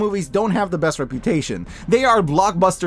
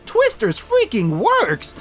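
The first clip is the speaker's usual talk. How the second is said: slow, higher, every word leaned on; emphatic verdict; 7 semitones higher, much slower than usual. Real, not acted. Reviewer declares the sequel works, slow emphatic delight.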